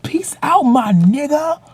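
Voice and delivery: high, casual street-slang imitation; peer's mock bro goodbye. Peer signing off to David in a high mock bro voice. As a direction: mimic the dude trying too hard. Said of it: "he's like".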